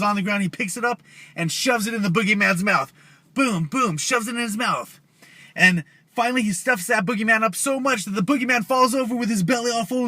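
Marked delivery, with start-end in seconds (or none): none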